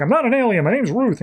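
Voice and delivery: high-pitched, mock-frantic protest; frantic denial. Ruth grabbing the detective by the collar, frantic denial. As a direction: plead the retold denial high and urgent.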